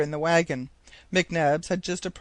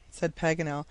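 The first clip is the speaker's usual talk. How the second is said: ordinary delivery, nothing marked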